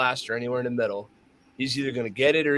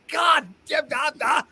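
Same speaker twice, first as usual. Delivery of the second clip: startled yelp. Retold or imitated. Rattled shooter caught off guard by the beep, hammed-up yelp. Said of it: "And he's like".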